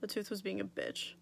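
normal talking voice

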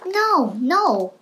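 put-on protesting child cry; cried out. A narrator's reading. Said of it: "cried Froggy".

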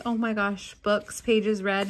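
ordinary delivery

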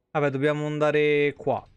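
ordinary talk

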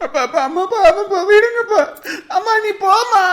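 put-on child pleading through tears to stop the fight; child begging father not to hit mother; crying plea.

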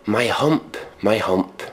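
ordinary talk, nothing marked

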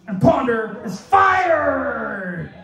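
high, slow drawn-out shout; mock announcement. Comic announces his own firing, mock shouting. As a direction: stretch the last word into a hammed-up cry.

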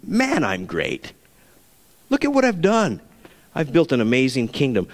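a smug, lightly voiced king admiring himself; boastful king. Proud king boasting over Babylon, mock smug. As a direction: puff up with mock pride.

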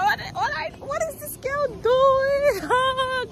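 high, drawn-out mock disbelief; imitating shocked passers-by in a stretched high voice; incredulous onlooker. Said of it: "the cars are looking at me like".

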